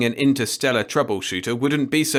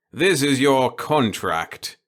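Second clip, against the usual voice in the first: put-on slow, higher, smug old boss; gleeful old boss; 4 semitones higher, 4 dB louder, slower than usual. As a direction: savour every word of it. The boss voiced in story.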